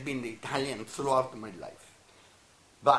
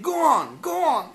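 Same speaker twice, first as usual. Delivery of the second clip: urging; 13 semitones higher, 10 dB louder than usual. Retold or imitated. Retelling Primo Levi's urging.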